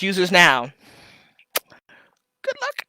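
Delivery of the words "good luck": squeaky good luck. Pretend. Playful sendoff.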